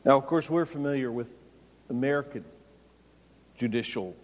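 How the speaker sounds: usual speaking voice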